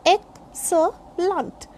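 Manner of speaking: excellent broken up slowly as ek, suh, lunt; syllable by syllable. Shown as a demonstration. Teacher breaking down excellent for learners, slow separate syllables. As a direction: pause between each syllable of excellent. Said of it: "sound should be there".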